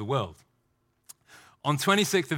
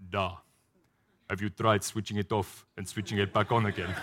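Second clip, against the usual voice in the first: lightly Russian-tinged IT helpdesk voice; deadpan helpdesk script with a Soviet da; mock tech support; 4 semitones lower, 3 dB quieter than usual. Soviet IT support answering Petrov, mock deadpan. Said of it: "he calls I T tech support".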